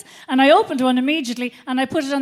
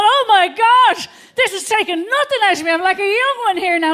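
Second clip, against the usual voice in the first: high loud thrilled inner voice; giddy thrill; 6 semitones higher, 5 dB louder than usual. Retold or imitated. Comic voicing her thrilled thoughts, high and loud. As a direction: gush like you've found youth again.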